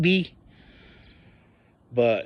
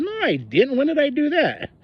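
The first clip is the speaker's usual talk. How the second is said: raised pitch in protest; indignant protest; 12 semitones higher than usual. Retold or imitated. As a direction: push back, voice climbing higher. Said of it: "And I said".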